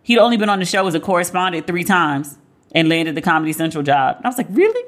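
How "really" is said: high, incredulous questioning rise; retold surprise. Host recalls her reaction to his thin résumé, surprised. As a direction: pitch leaps up in disbelief.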